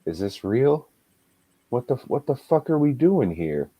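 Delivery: disbelieving, half to himself; incredulous. Retold or imitated. Brother asking what they're doing here, baffled. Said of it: "facial expressions, like".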